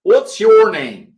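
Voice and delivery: slow, careful repeat of the phrase; slow teacher demo. Shown as a demonstration. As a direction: repeat it slowly and clearly. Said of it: "Mesma coisa".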